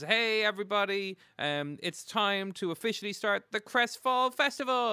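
high, loud festival announcer; mayor addressing crowd. Acted. Game master as the mayor opening the festival, proclaiming. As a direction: proclaim it to the whole square, building. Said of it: "the mayor walks out and says".

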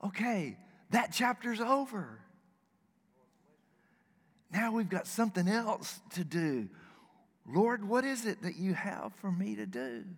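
a hint of upbeat resolve as he turns to God; hopeful wrestler. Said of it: "No, he's like".

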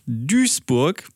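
„Duisburg“ wie „Düsburg“; ui als ü. Shown as a demonstration. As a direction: sprich das ui in „Duisburg“ als langes ü. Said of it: "in D U I S Burg". (langes ü in „Duisburg“)

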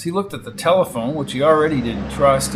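ordinary delivery, nothing marked

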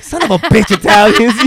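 loud, high mock-indignant outburst; comic outrage. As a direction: curse them in exaggerated exasperation. Retelling her outraged reaction.

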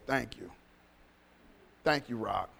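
soft, low voice of thanks; imitating a priest's hushed, grateful prayer; hushed gratitude. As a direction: say it quietly, as if up to heaven.

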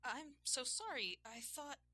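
high, slow, embarrassed apology; flustered apology. A narrator's reading. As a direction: apologise in a high voice, then break off. Embarrassed apology after snooping, cut short.

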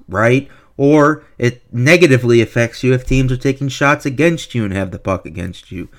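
ordinary delivery

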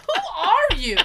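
high, loud disbelieving question; indignant retort. Retold or imitated. Patient retelling her comeback to the doctor, indignant. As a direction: throw it back at them, high and incredulous.

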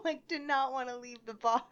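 ordinary talk, nothing marked

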